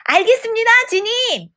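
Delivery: high, eager reply; excited angels. A narrator's reading. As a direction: answer brightly in delight, pitched well up. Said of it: "세 천사들은 신이 나서 대답했습니다".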